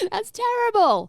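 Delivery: high, slow, stretched mock outrage; mock horror. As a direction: act scandalised in a high drawn-out voice. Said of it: "mock horror at joke".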